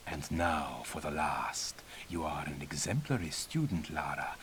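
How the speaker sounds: soft unvoiced whisper of a sly old teacher; conspiratorial whisper. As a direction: whisper it like a secret between mentor and pupil. Mentor confides secret challenge to pupil.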